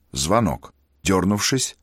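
ordinary delivery

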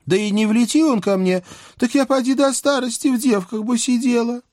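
high, sweet scheming maiden; young princess voice. A narrator's reading. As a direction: plead sweetly while twisting things. Princess Vasilisa voiced by reader.

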